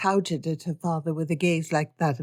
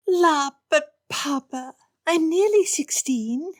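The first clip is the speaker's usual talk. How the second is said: put-on high, pleading teenage girl voice; a spoilt girl wheedling her father; girlish whine; 11 semitones higher, slower than usual. Spoilt daughter begs Papa for a ball, high girlish whine.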